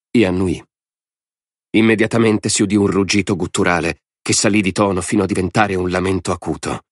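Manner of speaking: usual speaking voice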